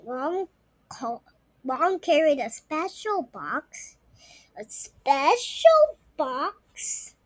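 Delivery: ordinary delivery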